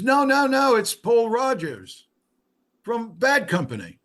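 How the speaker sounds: high, loud imitation British voice; British accent. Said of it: "with a British accent".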